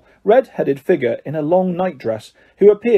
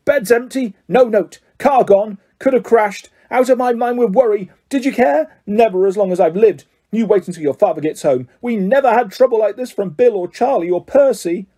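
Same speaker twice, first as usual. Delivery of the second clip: raging mother; 6 semitones higher than usual. A narrator's reading. Mother scolding her sons for taking the car, in a furious rant. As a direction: let the rage break over them. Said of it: "her rage broke over them".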